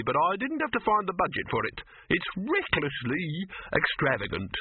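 high, stiff objection. A narrator's reading. Silverfish complains to Dibbler about the cost, stiff. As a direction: protest stiffly, stretching out the final words. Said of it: "he said stiffly".

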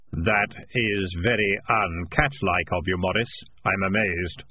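slow, measured voice of Death; solemn, deliberate Grim Reaper. Acted. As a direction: pronounce it slowly as Death. Death speaking.

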